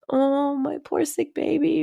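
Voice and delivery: high, slow, fussing mother; doting mom. Retold or imitated. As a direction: coo like a worried mom. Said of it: "she's gonna be like".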